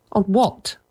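put-on a short, lightly snapped question from a boy; a hint of a sulky son snapping; mild snap. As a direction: clip it back at your father, mildly. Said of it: "snapped Peter".

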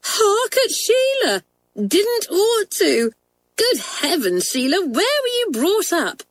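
high-pitched, scornful scolding; in a rage. A narrator's reading. Janet scolding a schoolmate about her speech, enraged. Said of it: "Janet flung down her book in a rage".

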